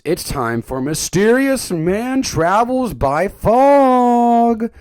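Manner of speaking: loud, high, drawn-out announcement; slow, over-the-top announcer call; mock show announcer. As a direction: belt it out like a segment title. Announces a running bit.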